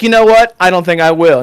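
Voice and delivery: loud, high snippy brush-off; sassy refusal. Retold or imitated. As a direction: toss it off like a haughty clerk. NPC refusing after failed persuasion.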